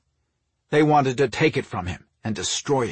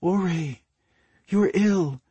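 higher, gentle wifely plea; tender request in a raised pitch; 6 semitones higher than usual. A narrator's reading. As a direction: coax him gently, voice lifted. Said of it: "requested in a gentle tone".

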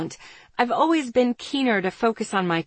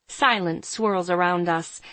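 ordinary delivery, nothing marked

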